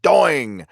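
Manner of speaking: cartoon spring boing; springy "doing" noise; imitated boing. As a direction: voice a comic spring sound effect. Imitated comic sound effect.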